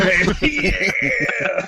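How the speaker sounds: mock sultry. Mocking sexual reading of line.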